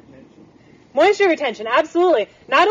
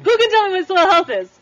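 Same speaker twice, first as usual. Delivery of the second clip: asked again higher and louder, coaxing the silent crowd; louder prodding repeat; 5 semitones higher than usual. Real, not acted. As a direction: push the question out again to get hands up. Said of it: "I don't see any hands".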